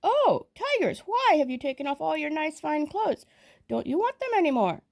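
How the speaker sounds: high calling to the tigers; called out to the fighting tigers, high. A narrator's reading. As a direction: call up high across to them. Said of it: "called out".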